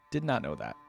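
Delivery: ordinary talk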